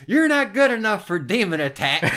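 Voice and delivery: loud, high, laughing mock taunt; laughing through dad's taunt. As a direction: taunt him while cracking up.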